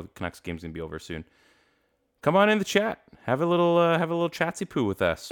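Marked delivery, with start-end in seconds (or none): 2.2-5.3 much higher, sing-song invitation to the chat; playful high voice. Real, not acted. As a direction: coax viewers in with a silly high lilt.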